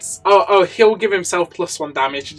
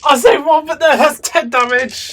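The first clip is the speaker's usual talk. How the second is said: excited outburst; 11 semitones higher, 6 dB louder than usual. Real, not acted. Dice roll goes badly.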